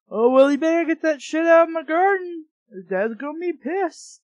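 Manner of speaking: high, loud whiny mimic; sneering whiny parody; mocking impression. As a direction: mimic the lazy brother mockingly. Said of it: "Don't just stand there and like".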